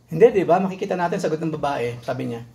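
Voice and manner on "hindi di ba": ordinary talk, nothing marked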